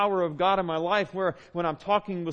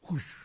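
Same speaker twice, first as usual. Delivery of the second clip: breathy whoosh noise; imitated whoosh; 6 dB quieter than usual. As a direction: mimic a rush of wind. Imitates storm suddenly calming.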